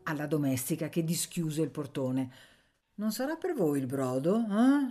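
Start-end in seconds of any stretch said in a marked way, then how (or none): 3.0-4.9 mock suspicious sneer, rising on eh; a servant's smirking doubt. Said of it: "sogghignò la serva". (ghigno sospettoso della serva)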